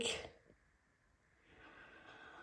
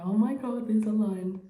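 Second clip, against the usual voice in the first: excited; 3 semitones higher, 8 dB louder than usual. Real, not acted. Sees line appear again.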